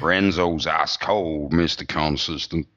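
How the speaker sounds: slow put-on American accent; drawn-out mock American bullet point; Big John Hart accent. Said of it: "do a big John Hart accent".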